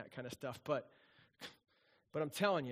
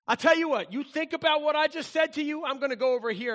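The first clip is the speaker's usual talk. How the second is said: louder, higher stern scold; parent scolding; 6 semitones higher, 6 dB louder than usual. Retold or imitated. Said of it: "parent scolding".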